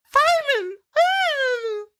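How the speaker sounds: very high sped-up squeaky chipmunk voice. Retold or imitated. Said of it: "I sound like a chipmunk".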